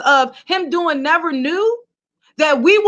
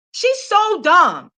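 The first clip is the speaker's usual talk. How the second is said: slow emphatic repeat; much slower than usual. Real, not acted. Vlogger repeating her verdict on [name] slowly. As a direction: draw it out slow, word by word.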